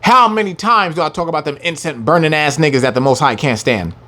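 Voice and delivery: ordinary talk, nothing marked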